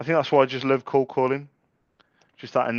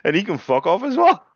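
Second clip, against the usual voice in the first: mock caller; 9 semitones higher, 7 dB louder than usual. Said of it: "she went".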